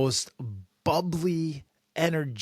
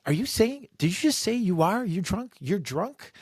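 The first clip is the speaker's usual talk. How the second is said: incredulous; 9 semitones higher than usual. Retold or imitated. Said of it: "she's like".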